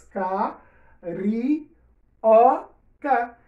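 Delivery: carioca split into ca, ri, o, ca with a pause after each; syllable by syllable. Shown as a demonstration. Teacher dividing carioca for the class, syllable by syllable.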